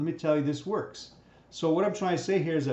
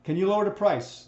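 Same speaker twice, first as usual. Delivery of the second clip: higher, louder customer question; customer asking; 5 semitones higher, 4 dB louder than usual. Retold or imitated. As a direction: push for the discount.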